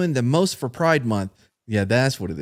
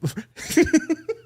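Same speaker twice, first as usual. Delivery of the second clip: high burst of laughter. Real, not acted. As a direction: break into a sudden laugh. Cracks up while reading.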